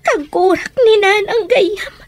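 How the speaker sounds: normal talking voice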